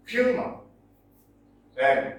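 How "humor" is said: "humor" as HU-mor, first syllable pitched high; teacher's front-stressed "humor". Shown as a demonstration. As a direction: lift the opening of "humor", light ending. Teacher repeats stress demonstration.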